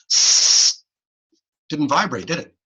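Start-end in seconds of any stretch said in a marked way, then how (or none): 0.1-0.8 long voiceless hiss; just air, no voice, on the S. Shown as a demonstration. Teacher showing an unvoiced S to the class. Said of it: "say the letter S".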